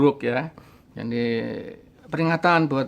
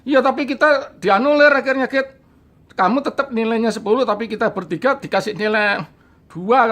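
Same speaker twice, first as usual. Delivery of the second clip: higher, louder whiny complaint; friend's complaint; 7 semitones higher, 5 dB louder than usual. Retold or imitated. Friend complains to him their grades were annulled, higher whiny voice. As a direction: whine it like the friend who lost marks.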